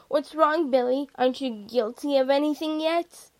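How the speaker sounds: loud jeering questions; taunting call. A narrator's reading. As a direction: goad him from the next car. Driver taunts from other car.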